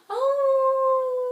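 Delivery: high, loud wolf howl; playful loud howl. Shown as a demonstration. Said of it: "wolf howling at the moon".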